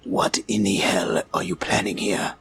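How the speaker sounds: hissed low through clenched teeth; through gritted teeth. Acted. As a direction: whisper it without moving the mouth. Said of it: "so through my teeth".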